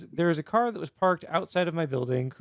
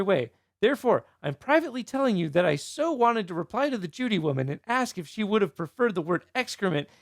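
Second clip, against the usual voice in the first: higher-pitched, exclaiming read-aloud; animated message reading; 5 semitones higher than usual. Retold or imitated. Podcaster reading a neighbor's private message about Judy to co-hosts, animated. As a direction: read it out high, with mock indignation.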